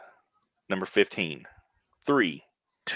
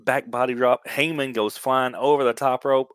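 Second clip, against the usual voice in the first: ordinary talk, nothing marked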